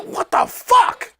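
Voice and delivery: very high, drawn-out incredulous cry; squealed, stretched-out amazement; mock disbelief. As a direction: replay your astonishment, slow and way up high. Retelling his amazed reaction.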